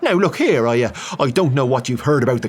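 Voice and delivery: Irish priest huffing back; flustered Irish priest. Acted. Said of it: "The Irishman bristled".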